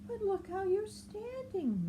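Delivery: high, bright questioning voice; Marabou asking. Acted. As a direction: ask it up high and cheerful. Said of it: "Marabou said".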